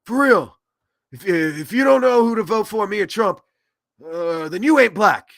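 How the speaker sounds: put-on loud, halting old politician's voice; Joe Biden. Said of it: "Biden goes on there and says".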